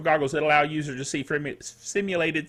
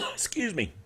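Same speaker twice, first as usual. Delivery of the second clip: normal talking voice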